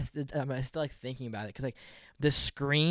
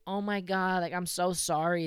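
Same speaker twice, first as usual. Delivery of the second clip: imitated gushing apology, pitched higher; apologetic dog owner; 6 semitones higher, 3 dB louder than usual. Dog's owner to him after the chase, mock apologetic. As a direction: play the other owner apologizing, voice lifted. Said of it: "And she's like".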